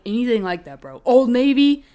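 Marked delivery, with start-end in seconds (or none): 1.0-1.8 loud. Acted. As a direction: blurt the brand name out loud. Plugging a brand loudly.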